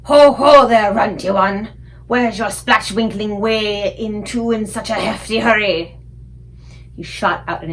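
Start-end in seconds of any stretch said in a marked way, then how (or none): 0.0-6.0 big booming giant, bullying. A narrator's reading. Flesh Lump Eater taunting the runty BFG, booming giant. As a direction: loom over the little one and sneer.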